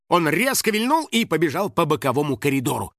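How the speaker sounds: ordinary delivery, nothing marked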